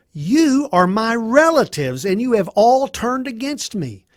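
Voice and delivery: high-pitched, emphatic reproach; hurt accusation. Acted. Job accusing relatives who turned against him, hurt.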